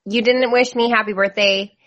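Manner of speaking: ordinary talk, nothing marked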